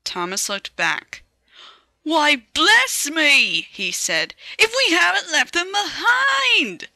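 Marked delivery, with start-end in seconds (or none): 2.0-6.9 surprised. Acted. Thomas discovers missing coaches.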